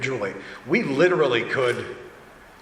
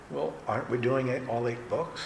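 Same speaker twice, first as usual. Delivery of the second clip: soft, hesitant phone voice; imitating a quiet caller; 7 dB quieter than usual. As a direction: say it quietly, as a tentative question. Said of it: "It was very quiet".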